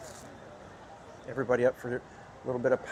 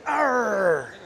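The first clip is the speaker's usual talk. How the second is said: pirate growl; 7 semitones higher, 4 dB louder than usual. Retold or imitated. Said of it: "like, went".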